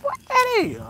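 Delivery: very high, loud bewildered "What that is?"; startled question. Retold or imitated. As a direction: sound alarmed and baffled by the noise. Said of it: "I'm like".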